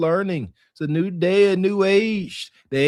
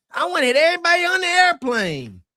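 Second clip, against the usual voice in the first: high, mock whiny imitation of her complaint; mocking her quote; 11 semitones higher than usual. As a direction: parrot her words back in a high, mocking voice. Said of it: "She said she wanted to cuss".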